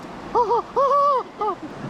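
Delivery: high shriek in cold water; scream-laugh from the cold. Real, not acted. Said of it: "stop screaming".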